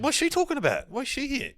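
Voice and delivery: high-pitched bewildered self-quote; high, puzzled mock protest; baffled questioning. Acting out his baffled reaction.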